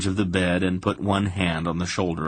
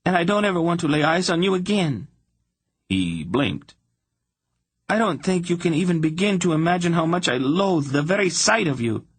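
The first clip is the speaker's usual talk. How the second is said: much higher, fast and seething; spurning; 10 semitones higher than usual. A narrator's reading. Princess telling Geryon she loathes him, seething. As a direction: hurl the contempt at him.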